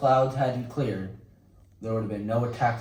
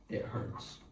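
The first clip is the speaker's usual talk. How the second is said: hurt whisper; 12 dB quieter than usual. Acted. Admitting his generals lied.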